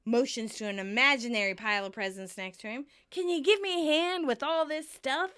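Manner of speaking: acted sarcastic rant, climbing at the end. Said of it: "Chandler sarcastically says".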